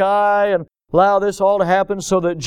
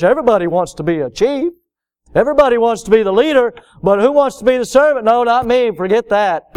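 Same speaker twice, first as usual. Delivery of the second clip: high, quick, forceful preacher's delivery; impassioned preaching; 6 semitones higher, 4 dB louder, faster than usual. Real, not acted. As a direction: press the congregation with rising urgency.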